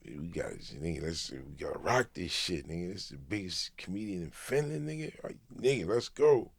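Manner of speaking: low, hushed, eager urging; hushed hype. Retold or imitated. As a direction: psych your buddy up under your breath. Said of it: "And Charlie's like".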